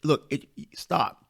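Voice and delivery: ordinary talk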